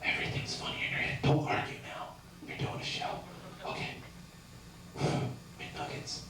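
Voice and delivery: quiet unvoiced inner voice; whispered self-talk. Comic's inner voice whispering doubts mid-show. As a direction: mutter to yourself in a whisper. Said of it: "My next impression".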